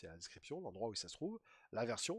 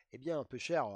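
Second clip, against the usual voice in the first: louder, hammed-up Marseille accent; 3 semitones higher, 9 dB louder than usual. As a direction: put on a jokey Marseille accent. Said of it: "c'est quoi cet accent marseillais".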